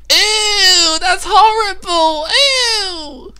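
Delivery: high shrill disgusted squeal. Pretend. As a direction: squeal in mock disgust at a smell. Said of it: "you'll just be like".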